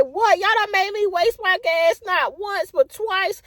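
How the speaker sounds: high, indignant rant; mock outraged tirade at the company; high furious complaint; ranting to them.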